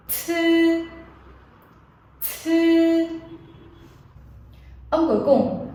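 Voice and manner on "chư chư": "Chư" with a strong burst of breath, the tongue flat; aspirated demo. Shown as a demonstration. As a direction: blow the air out on "Chư". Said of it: "các bạn sẽ bật hơi ra cùng cô nhé". (đọc mẫu "Chư" bật hơi)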